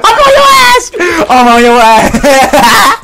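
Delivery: high, loud laugh-shouted taunt. Real, not acted. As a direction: howl it through laughter. Laughing at friend's rizz attempt.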